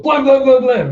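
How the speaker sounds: loud, high rapid blams; excited imitated gunfire; gunshot noises. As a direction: fire off blams like a pistol.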